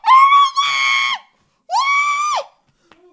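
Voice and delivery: long, very high, loud screech; real terrified scream. Real, not acted. Interrupted mid-sentence by the spider, long terrified scream. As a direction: shriek slowly, stretched out in fright.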